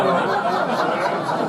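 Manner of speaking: loud laughing burst; burst of laughter. Real, not acted. Speaker laughing at his own joke, laughing out loud. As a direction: laugh out at the crowd.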